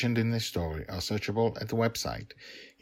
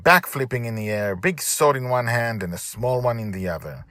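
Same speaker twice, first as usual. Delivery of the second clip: usual speaking voice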